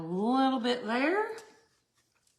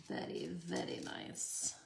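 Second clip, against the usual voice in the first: much lower, softer mock-refined "veddy veddy"; deep, hushed put-on connoisseur voice; playful put-on voice; 10 dB quieter than usual. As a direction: say it low and quiet, tapping the r's in "very". Playful echo of own praise.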